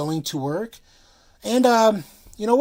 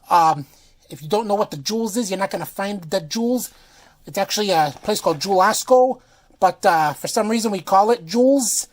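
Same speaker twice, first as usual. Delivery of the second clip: fake Chicago accent. Touring viewers round Chicago in a hammed-up Chicago accent. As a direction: put on a thick mock Chicago accent, talking up the city. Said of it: "actual review in the fake Chicago accent".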